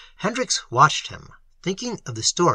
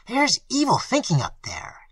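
suggestive; 8 semitones higher than usual. A narrator's reading. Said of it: "he said suggestively".

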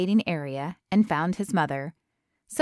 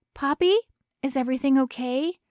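put-on worried girlfriend voice; 6 semitones higher, faster than usual. A narrator's reading. Worried girlfriend answers phone.

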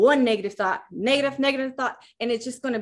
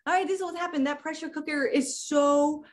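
ordinary talk

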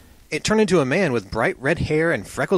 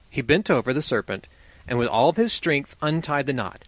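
ordinary delivery, nothing marked

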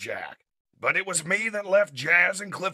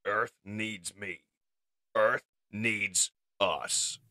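low, slow, cold declaration; deliberate, unyielding leader; Optimus Prime coldly stating; 7 semitones lower, slower than usual. Acted. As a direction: state it slowly in a deep, icy voice. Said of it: "Optimus coldly states".

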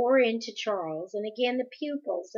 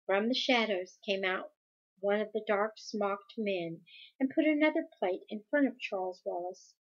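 plain reading voice

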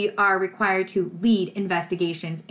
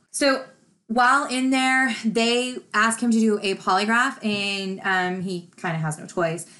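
usual speaking voice

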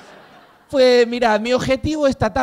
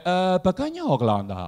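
put-on slow, softer Chinese-accented question; drawn-out mock Chinese accent; mock Chinese shopkeeper accent; 5 dB quieter than usual. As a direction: ask as a caricatured Chinese shopkeeper. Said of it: "porque te dice".

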